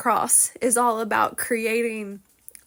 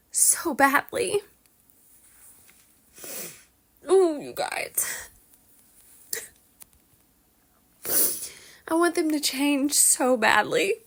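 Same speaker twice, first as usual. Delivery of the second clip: close to tears; 4 semitones higher than usual. Real, not acted. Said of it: "I'm crying".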